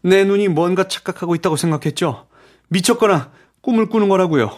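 high-pitched agitated recounting; bewildered. A narrator's reading. Raoul recounts doubting his own eyes.

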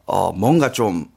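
normal talking voice

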